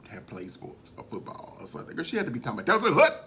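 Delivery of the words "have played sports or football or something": whispered aside. Real, not acted. Whispering a dig about football shoulders. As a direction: drop to a hush for the dig. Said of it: "unvoiced aside".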